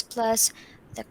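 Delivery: ordinary delivery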